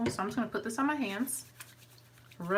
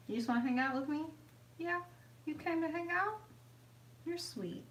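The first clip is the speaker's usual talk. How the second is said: high, soft cooing to a dog; pet talk; 8 semitones higher, 4 dB quieter than usual. Real, not acted. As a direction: coo sweetly at your dog, pitched up and gentle. Sweet-talking her dog.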